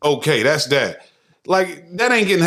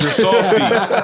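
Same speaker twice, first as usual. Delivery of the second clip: laughing; 4 semitones higher than usual. Acted. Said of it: "mocking soft men".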